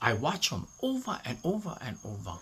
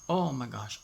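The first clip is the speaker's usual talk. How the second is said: normal talking voice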